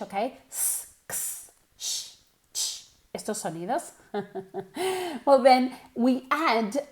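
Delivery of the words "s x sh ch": S, x, sh, ch hissed without voice; sibilant sounds. Shown as a demonstration. Teacher shows the sibilant sounds to students. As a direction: hiss each sibilant on its own. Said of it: "sonidos que llamamos sibilants".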